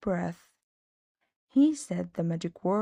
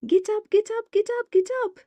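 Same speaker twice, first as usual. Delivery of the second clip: high, louder croak, urgent; croaking cat; 13 semitones higher, 5 dB louder, much faster than usual. A narrator's reading. Cat Tabitha wakes Max, high urgent croak. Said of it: "she croaked".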